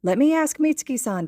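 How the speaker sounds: lightly voiced gentle mother; mother's reply. A narrator's reading. Mother fondly answers her eager son.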